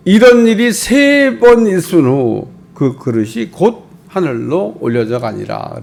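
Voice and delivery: 천천히 엄숙하게 읽는 본문; 한 구절씩 무게 있게 낭독. Acted. (설교 중 본문 구절을 천천히 엄숙하게 낭독)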